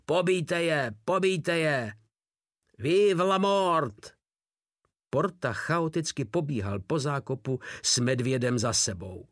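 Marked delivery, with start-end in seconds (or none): 0.0-4.1 high, drawn-out murderous war cry; battle cry. A narrator's reading. Drobeček rallies men in the trench, murderous battle cry. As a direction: cry it out high over the din. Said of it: "vražedný bojový pokřik".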